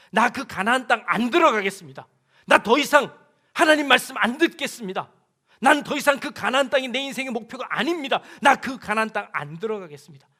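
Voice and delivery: loud defiant refusal; defiant declaration. Acted. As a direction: proclaim the refusal loudly to God. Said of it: "선포를 해버리는 겁니다".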